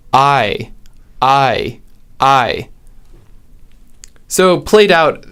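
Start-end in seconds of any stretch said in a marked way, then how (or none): 0.1-2.7 "I" with a wide open vowel; open diphthong. Shown as a demonstration. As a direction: say "I" as in rider. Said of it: "the diphthong is".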